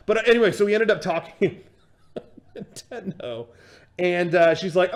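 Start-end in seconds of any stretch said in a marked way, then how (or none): 2.1-3.5 soft word lost in laughter; helpless amused chuckle through the word; laughing. Real, not acted. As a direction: crack up while saying it. Cracks up at the absurdity.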